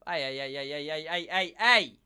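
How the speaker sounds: playful escalating objection; mock protest. Mock protest at insults in chat.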